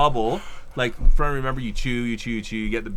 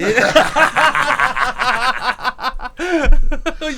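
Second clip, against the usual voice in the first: loud high laugh; 12 semitones higher, 7 dB louder than usual. Real, not acted. Bursts out at lizard tongue.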